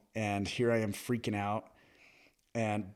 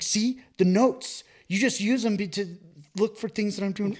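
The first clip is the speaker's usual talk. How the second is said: high, louder whiny take on the boss; mock boss voice; 11 semitones higher, 5 dB louder than usual. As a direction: play the boss as a high nagging caricature. Mocking voice for the boss.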